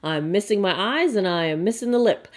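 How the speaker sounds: ordinary delivery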